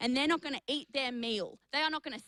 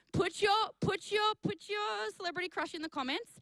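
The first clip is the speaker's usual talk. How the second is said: excited call out; 8 semitones higher than usual. Real, not acted. Urging viewers to comment.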